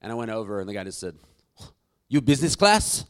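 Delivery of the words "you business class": loud, hammed-up French accent; mock French attendant, voice raised and rising; bad French accent. Lounge attendant questioning a traveller, bad French accent. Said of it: "This is a really bad French accent".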